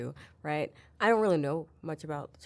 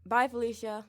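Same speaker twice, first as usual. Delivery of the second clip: sassy farewell; 6 semitones higher, 5 dB louder than usual. Pretend. Sassy dismissive catchphrase.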